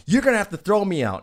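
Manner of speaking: high, loud mock challenge; belligerent defiance. Retold man defies being thrown out.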